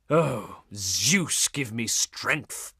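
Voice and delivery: low, weary appeal to the heavens; exasperated. Acted. Envoy praying for strength with a dolt, exasperated. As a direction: sink low and slow, out of patience. Exasperated prayer after being misunderstood.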